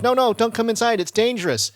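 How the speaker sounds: high-pitched mock plea; imitated urgent warning. Trapped boyfriend warns his girlfriend off, high mock urgency. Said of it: "he calls out to her for help".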